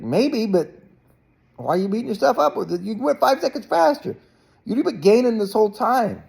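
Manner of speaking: upbeat encouraging dad. Retold or imitated. Dad cheering his disappointed swimmer son, high upbeat praise. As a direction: pump him up like a coach on the sidelines.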